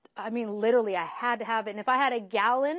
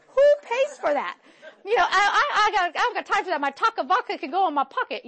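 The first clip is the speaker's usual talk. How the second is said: laughing; 9 semitones higher, 7 dB louder than usual. Retold or imitated. Mocking her old drinking self.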